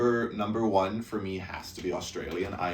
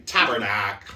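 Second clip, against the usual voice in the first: put-on loud Albertan-accented exclamation; Albertan politician; 6 dB louder, slower than usual. Showing co-host the Albertan guy, loud Albertan accent. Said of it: "Like some Albertan politician".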